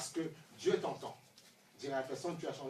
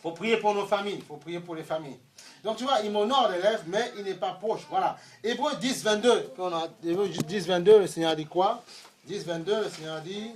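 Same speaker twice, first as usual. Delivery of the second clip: loud, driving preacher's call; full-force preaching; 4 semitones higher, 14 dB louder than usual. Acted. Preacher urging the congregation to pray, loud preaching. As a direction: preach it out to the whole room.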